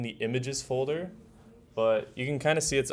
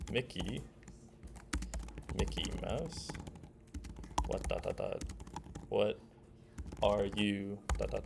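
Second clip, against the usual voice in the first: mock Among Us voice; 9 dB quieter than usual. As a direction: tease Mickey in a light spooky voice. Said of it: "And then Among Us says".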